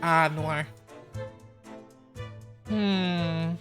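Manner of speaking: ordinary talk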